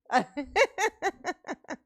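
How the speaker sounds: high giggle running down in pulses; real burst of high laughter; laughing. Real, not acted. Mama amused while handing out freebies, laughing.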